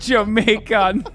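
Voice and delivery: laughing, drawn-out Jamaican imitation; mock Jamaican accent. Friend mocking the contestant's Jamaican accent, laughing. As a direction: stretch Jamaican slowly in a Jamaican accent. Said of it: "where did this Jamaican accent".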